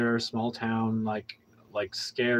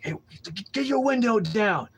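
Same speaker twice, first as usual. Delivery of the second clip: aggressive cop; 9 semitones higher than usual. Retold or imitated. Cop ordering the driver's window down, aggressive bark. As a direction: bark it like a cop coming on strong. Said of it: "he came on really strong".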